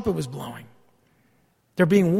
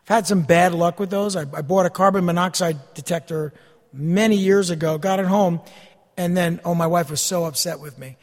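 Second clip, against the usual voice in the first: usual speaking voice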